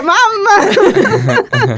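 high, laughing cry of Mamma; laughing shout. Retold or imitated. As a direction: yelp it out through laughter. Calling out to mother who hung up.

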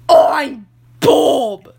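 loud excited announcement; shouted intro. Acted. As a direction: burst in shouting your name. Character shouts own intro.

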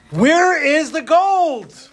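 high, slow and bewildered; shocked cry. Acted. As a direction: stretch it out in disbelief. Townspeople find only poop.

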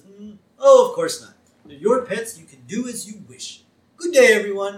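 mock-gracious old schemer, swooping high; Burns. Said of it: "Burns says".

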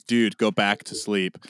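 low, slow, unimpressed mutter; a dog that can't be bothered; dog's bored voice. Retold or imitated. Voicing the dog Grievous, low and unbothered. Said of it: "Grievous was looking at me like".

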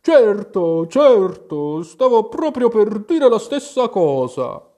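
high, loud, sleepy creature voice; drowsy dormouse mumble. A narrator's reading. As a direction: mumble it half asleep, pitched high. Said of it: "borbottò, senza neppure aprire gli occhi". (borbottio di ghiro assonnato)

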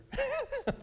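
high scoffing laugh; mocking laugh. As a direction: laugh her off. Disciples scoff.

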